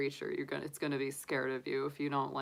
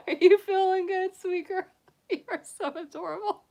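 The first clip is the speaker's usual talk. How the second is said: much higher, louder giggling coo; 9 dB louder than usual. Real, not acted. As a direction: gush at the bunny through laughter. Baby talk to bunny, laughing.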